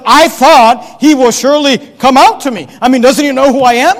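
loud, affronted acted outburst; indignant anger. Naaman complaining that Elisha will not come out, indignant. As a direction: play an offended great man, punching the words. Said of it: "He's all angry".

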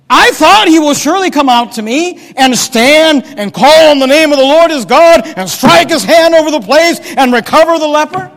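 loud, high indignant rant; angry tirade. Acted. Naaman listing what he expected of Elisha, angry. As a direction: hammer each expectation in acted outrage. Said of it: "He's all angry".

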